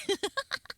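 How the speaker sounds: high squeaky giggle; squeaky gleeful gremlin giggle. Real, not acted. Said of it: "my gremlin voice".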